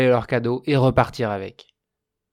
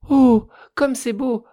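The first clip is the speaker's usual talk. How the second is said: ecstatic cries; 10 semitones higher, 6 dB louder than usual. A narrator's reading. Fire spirit marvelling at wood and stones, ecstatic. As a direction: gush over each treasure like a child. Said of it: "et poussait des".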